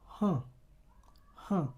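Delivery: lips stretched nasal huh; smiling nasal sound demo. Shown as a demonstration. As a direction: say huh in a tight smile. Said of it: "It's called the smiling sound".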